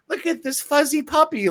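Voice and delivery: high, gushing puppy-post voice; mock innocent poster. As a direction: coo over a puppy, exaggerated.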